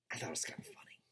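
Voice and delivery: whispered confession; hushed amused aside. Real, not acted. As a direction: whisper it to the camera. Private aside to viewers.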